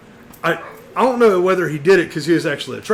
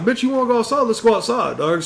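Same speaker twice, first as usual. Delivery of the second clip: higher, taunting call-out; challenge to fight; 7 semitones higher than usual. Retold or imitated. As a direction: dare them to step outside. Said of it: "so I'm like".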